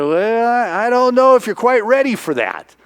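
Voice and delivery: high, hedging acted reluctance; hesitant doubt. Trainer doubting boy is ready, high hesitant hedging. Trainer hedges at boy's wish.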